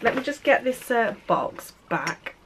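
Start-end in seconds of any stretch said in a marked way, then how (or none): none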